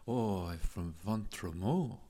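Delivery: mock French accent. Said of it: "if I could speak French or do a French-English accent".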